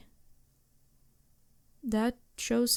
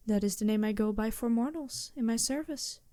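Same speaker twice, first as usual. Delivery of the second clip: ordinary talk, nothing marked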